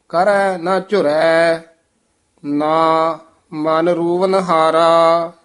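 measured Gurbani reading with drawn-out endings; sustained, chant-like Punjabi scripture reading; scripture recitation. Shown as a demonstration. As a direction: recite phrase by phrase, pausing after each.

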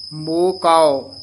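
slow ਮੋਹ ਕਉ, ਕਉ said as kau, not ko. Shown as a demonstration. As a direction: stretch ਕਉ into kau. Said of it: "ਇਹਦਾ ਉਚਾਰਨ ਕਾਉ ਕਰਨਾ ਹੈ".

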